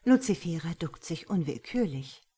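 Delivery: plain reading voice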